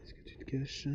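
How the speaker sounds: whispered to the ground; breathy searching whisper. Real, not acted. Searching for the target.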